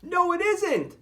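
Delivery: high, sharp snap; Shelley snapping at the bird; snapped. Acted. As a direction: snap back crossly. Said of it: "snapped Shelley".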